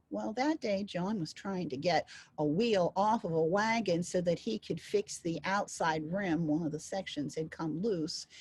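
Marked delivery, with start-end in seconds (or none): none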